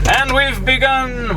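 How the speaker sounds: high, slow grand announcement; announcer voice. Acted. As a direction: proclaim the start like a showman. Announcing start of trip.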